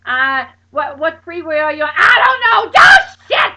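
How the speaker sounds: high, loud panicked screams; hollering and screaming. Retold or imitated. Friend hollering over the phone, frantic screams. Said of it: "hollering and screaming".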